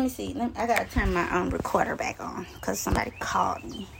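whispered aside about the recorder; hushed, breathy aside, half to herself. Real, not acted. Whispering to herself while turning her recorder back on.

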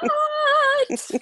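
high shocked squeal of what; a sudden stunned high cry. Retold or imitated. Everyone shocked at wedding.

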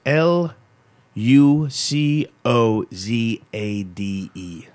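slow, letter by letter; spelled out slowly. Shown as a demonstration. Spelling the drink name slowly for listeners. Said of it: "how to spell Lucozade?".

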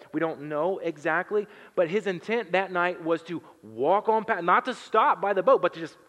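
loud, driving sermon voice; preaching at full force. Acted. Pastor stirring the congregation, loud preaching. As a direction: preach it out with rising fire.

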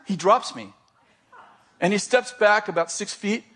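usual speaking voice

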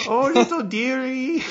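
very high dearie falsetto; Missus Doubtfire. Acted. Nanny fussing over "dearie", in a very high put-on voice. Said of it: "I'm Missus Doubtfire".

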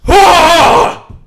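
short frantic high scream; high panicked scream. Retold or imitated. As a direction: shriek as you escape. Reenacting running out screaming.

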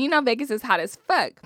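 ordinary talk, nothing marked

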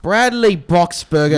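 loud, high wizard-movie announcement; Harry Potter voice. Shown as a demonstration. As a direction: boom the name like a Hogwarts professor. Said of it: "You sound like you should be in Harry Potter".

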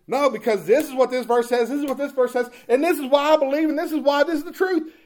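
retelling his heated argument, voice climbing high and loud; pressing his point harder and harder; worked up. Retold or imitated. Debating a fellow preacher in his office, heated and worked up. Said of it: "I realize I'm really getting worked up".

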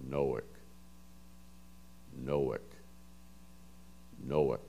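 "Noack" in a low, even voice, stressed NO-ick, repeated after long pauses; low recorded-guide delivery of "Noack", two syllables, NO-ick; imitating pronunciation voice. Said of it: "this voice says".

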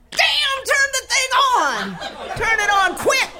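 very high, loud frantic order; mock urgent shout. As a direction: holler it as a comic emergency. Dying mother revives, demands machine.

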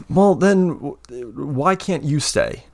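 a hint of a hurt young man's snarl, high; lightly voiced, sharp question with a sigh before; mild snarl. Omin hurt that Constance won't stay, mildly snarling. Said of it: "He snarls a little bit".

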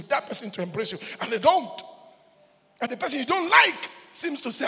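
loud, high call-and-response preaching; preacher rousing the congregation; preaching at full force. Acted. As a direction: build to a shouted close. Calls to church, builds.

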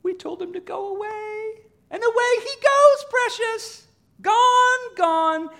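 very high, gleeful creature squeal; Smeagol. Acted. Preacher as Smeagol finding Gollum gone, jubilant squeal. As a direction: celebrate in a squeaky voice, slowing at the end. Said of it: "And Smeagol, he looks around".

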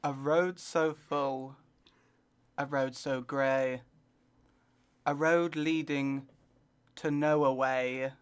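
very slow, spaced-out poetry reading; mock earnest poem recital, slow and deliberate. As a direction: read it like a solemn poet at an open mic.

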